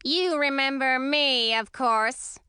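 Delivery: high, slow grumpy witch voice. Acted. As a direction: grumble it like an old witch. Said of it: "said Dyspepsia, looking grumpy".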